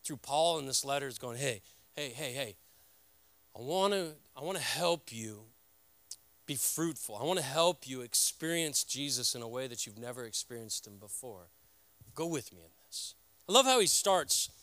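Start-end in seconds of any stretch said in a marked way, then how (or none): 1.4-13.1 gentle voice of the Spirit. Retold or imitated. Voicing the Spirit's gentle plea.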